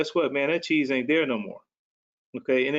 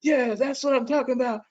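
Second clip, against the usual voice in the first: excited yelling; 12 semitones higher than usual. Retold or imitated. Speaker re-enacting yelling back about having to pivot, excited yelling. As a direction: yell it high, like egging someone on. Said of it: "I would start yelling".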